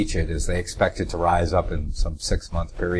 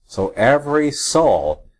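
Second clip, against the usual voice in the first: slow, high-pitched weight on "every"; stress on "every"; 6 semitones higher, slower than usual. Shown as a demonstration. Repeating the key word to listeners, stress on "every". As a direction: spell out "every soul" slowly, pitched up. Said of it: "I'm emphasizing the word every".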